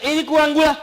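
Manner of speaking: high, loud mock complaint; complaining husband. Husband grumbles to his wife about his coffee, mock complaint. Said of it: "Suami ngata".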